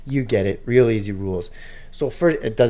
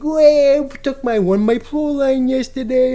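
much higher, put-on old gambler voice; Giovanni; 12 semitones higher, 3 dB louder than usual. Gambler G-Money brags to Ben about his win, high put-on voice. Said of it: "He's like".

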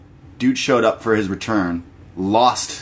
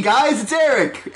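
mock puppet voice; 13 semitones higher than usual. Mocking Sesame Street voice.

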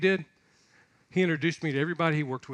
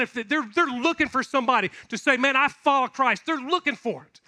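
higher, louder, urgent sermon push; fervent preaching; 6 semitones higher, 3 dB louder than usual. Real, not acted. Preaching at full force.

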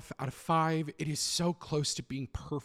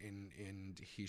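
ordinary delivery

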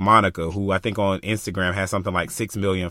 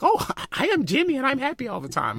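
very high-pitched, put-on cheery voice; mock someone who is relentlessly happy, much higher; fake-happy persona; faster than usual. Speaker mocking putting on a front to fit in, fake-happy voice. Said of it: "I'm not saying go in and be like".

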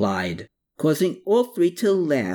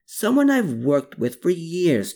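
higher-pitched, mildly insistent; 7 semitones higher than usual. A narrator's reading. Tunda vouching for his associate, insistent. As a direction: insist that he can be trusted. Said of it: "Tunda insisted".

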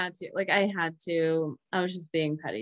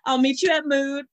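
Tim Gunn impression; 6 semitones higher, 5 dB louder than usual. Quoting Tim Gunn, high and loud.

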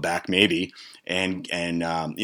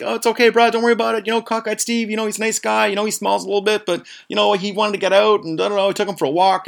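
high, sing-on-one-note soothing voice; smooth it over in a high-pitched imitation; placating buddy; 13 semitones higher, faster than usual. Tone excusing Cockeyed Steve to Chris, placating.

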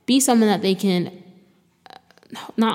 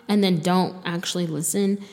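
ordinary talk, nothing marked

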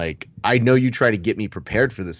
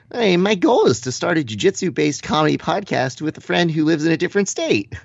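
much higher put-on voice; Keith Krikorian; 8 semitones higher than usual. Reading a friend's podcast goal, high put-on impression. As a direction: pitch it way up for the impression. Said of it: "in Keith Krikorian voice".

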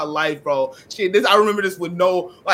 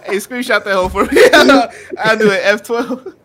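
laughing; 4 semitones higher, 9 dB louder than usual. Real, not acted. Laughing at frozen friend.

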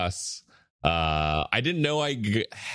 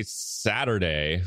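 ordinary talk, nothing marked

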